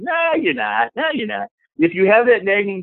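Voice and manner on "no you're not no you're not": high, dismissive no; scoffing doubt. Retold or imitated. Inner doubter scoffs at the affirmation, dismissive. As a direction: brush the claim off with a scoff. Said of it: "And he goes".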